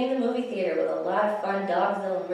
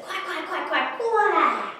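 rapid high quacks building louder; super excited duck; 9 semitones higher, 3 dB louder, slower than usual. Acted. Duck sees skydiving, super excited quacking. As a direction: quack fast and high, ending on a long squawk. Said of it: "he is super excited".

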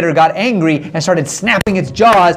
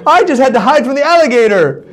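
higher, louder feigned surprise; mock surprise; 7 semitones higher, 3 dB louder than usual. Jose pretends surprise finding the woman hiding, higher and louder. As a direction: act startled to find her. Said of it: "He said".

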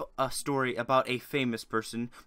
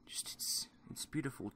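whispering; 14 dB quieter than usual. Pretend. Mock moved by emotional story.

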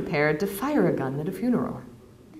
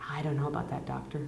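reluctant villager, much softer and withdrawn; uneasy Marek; 6 semitones lower, 13 dB quieter than usual. Acted. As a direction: hang back, doubtful, hardly voiced. Marek resists, hesitant and uneasy.

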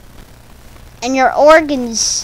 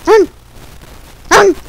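dog bark; 6 semitones higher, 6 dB louder than usual. Pretend. If people acted like dogs.

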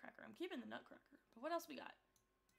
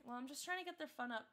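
ordinary delivery

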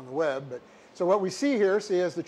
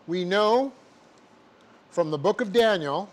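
dictating. Shown as a demonstration. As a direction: dictate it word by word, punctuation aloud.

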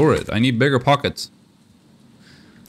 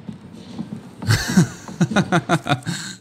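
long run of high laughter; real laugh; 8 semitones higher than usual. Real, not acted. Gamer amazed at what they somehow did, laughing. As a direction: burst into a rapid string of ha-has. Laughs at own lucky escape.

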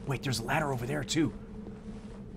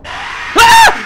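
very high, loud shriek; startled scream; 15 dB louder than usual. Real, not acted. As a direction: scream from a sudden scare. Jump scare in the game.

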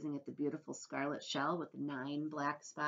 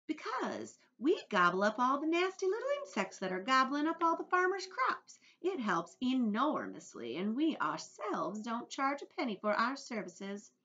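a raised, bright-eyed little beetle voice, quite pleased with herself; lightly voiced genteel ladybug, raised and proud; 8 semitones higher, 6 dB louder than usual. A narrator's reading. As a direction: speak as a genteel ladybug boasting of her usefulness. Ladybug explains to James.

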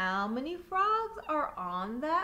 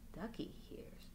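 hushed whisper before a surprise; suspense whisper; 6 semitones lower, 13 dB quieter than usual. A narrator's reading. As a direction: lean in and whisper. Suspense before frog's ribbit.

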